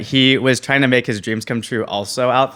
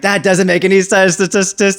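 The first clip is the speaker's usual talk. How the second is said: high, louder dismissive scoff; friend's scoff; 10 semitones higher, 8 dB louder than usual. Retold or imitated. Friend dismissing his gut feeling, loud scoff. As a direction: wave it off as nonsense. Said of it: "he's like".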